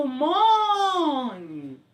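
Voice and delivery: MO in mone held very long and high; exaggerated long stressed syllable in mone. Shown as a demonstration. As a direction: stretch the MO of mone, letting it slide down. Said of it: "o mo é maior".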